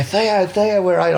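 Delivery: high call across the bush; calling a lost mate at night. Retold or imitated. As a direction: call a friend's name into the dark.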